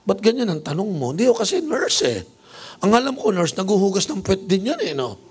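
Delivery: high, playful teasing; mock high banter. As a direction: tease her innocently. Nurse offended, bishop jokes back. (pabirong asaran, mataas ang boses)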